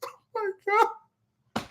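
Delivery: high, slow, laughing disbelief; overwhelmed with delighted surprise; half-laughing gasp of disbelief; stunned oh my god. Retold or imitated. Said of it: "I was like".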